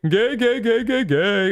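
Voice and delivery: high-pitched mocking chant; Oscar Isaac. Streamer mimicking an actor's behind-the-scenes protest, high and mocking. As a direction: rattle it off high in a silly mimicking voice. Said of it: "he's like".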